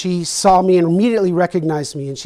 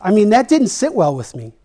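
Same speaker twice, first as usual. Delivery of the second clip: ordinary delivery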